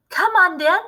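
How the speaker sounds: eager cry. A narrator's reading. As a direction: hurry him along. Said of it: "cried Mister Killy Cranky".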